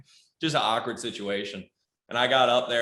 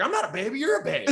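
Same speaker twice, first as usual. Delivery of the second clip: put-on higher, whiny childish echo; mimicking the kid; faster than usual. Comic mocks the kid's comeback in a higher whiny voice. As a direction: parrot him like a bratty kid.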